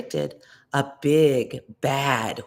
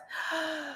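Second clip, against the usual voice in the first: sharp high intake of breath; big inhaled gasp; 9 dB quieter than usual. A narrator's reading. The wolf breathes in at the brick house, a big gasp. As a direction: fill the lungs to blow.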